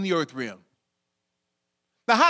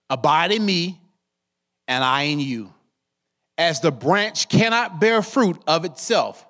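scripture reading; 4 semitones lower than usual. Acted. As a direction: read the Bible verse with weight. Reads scripture verse three.

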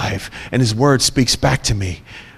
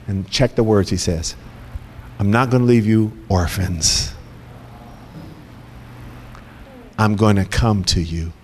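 low, soft reassurance; gently calm the frightened friends; Jesus tenderly promising not to leave; comforting Jesus; 5 semitones lower, 4 dB quieter than usual. Acted. Jesus comforting his disciples.

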